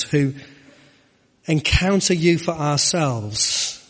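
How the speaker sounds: earnest congregational prayer, steady and full-voiced; leading prayer. Preacher praying for weeping mothers, earnest and full-voiced. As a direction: pray aloud over the congregation, fervent.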